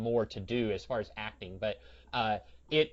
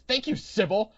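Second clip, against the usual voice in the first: put-on high, loud sitcom-kid quip; imitating Brighton; 8 semitones higher, 8 dB louder than usual. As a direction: punch it out like a cheeky TV boy. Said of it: "just the way he said Sybil".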